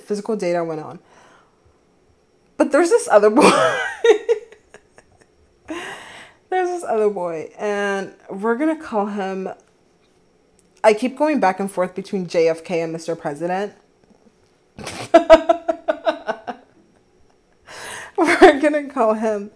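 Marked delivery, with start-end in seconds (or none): 2.6-3.5 higher and louder tease of the new story; grinning build-up to the gossip; excited. Real, not acted. Teasing the next story. 3.5-4.4 a high, delighted giggle. Real, not acted. Laughs at the story. 14.8-16.5 a long, high laugh; giggling laugh. Real, not acted. Laughs at the nickname.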